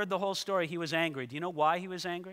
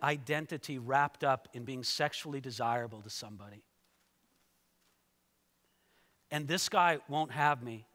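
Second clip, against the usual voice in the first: wife's inner thoughts; 4 semitones lower than usual. Acted. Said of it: "she's thinking".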